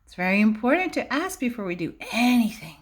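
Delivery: ordinary delivery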